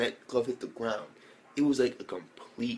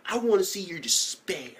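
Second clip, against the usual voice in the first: outraged disbelief; 7 semitones higher than usual. Retold or imitated. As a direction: rant at him in total disbelief. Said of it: "I'm like".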